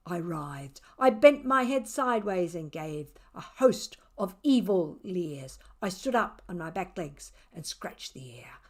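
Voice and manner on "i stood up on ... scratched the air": hushed, whispered telling; spooky whisper. A narrator's reading. Narrator whispering the cat's spooky show. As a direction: whisper it like a secret.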